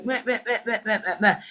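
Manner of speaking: light taunting bleats of meh; laughing mock chatter of meh. Mocking the gossipers with teasing bleats of meh. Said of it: "go over there and go".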